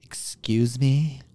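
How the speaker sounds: slow, indignant "Excuse me?" like a Karen; mock Karen being affronted. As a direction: draw it out, offended. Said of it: "Full blown Karen".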